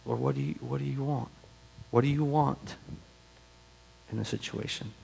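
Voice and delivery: very soft, searching plea to God; quiet, halting question to the Lord; hushed prayer. Acted. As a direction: pray it almost under your breath. Models a prayer asking God.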